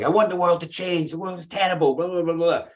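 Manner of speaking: high-pitched mock crusader rant. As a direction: demand change like a zealous crusader. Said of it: "the Crusader's like".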